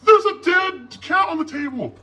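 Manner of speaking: high, loud alarmed shout. A narrator's reading. Lizzie shouting about the dead cat, high loud. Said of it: "shouted Lizzie".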